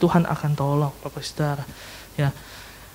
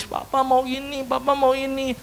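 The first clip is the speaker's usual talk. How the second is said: put-on whining child; 5 semitones higher, 5 dB quieter than usual. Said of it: "anaknya minta sambil nangis".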